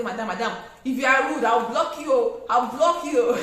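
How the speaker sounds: loud threatening yell, laughing at the end; loud threat. Shown as a demonstration. Seller threatening to block a rude customer, loud mock yell. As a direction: bark the threat at her. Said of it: "So maintain a calm voice".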